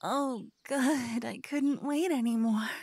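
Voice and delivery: ordinary delivery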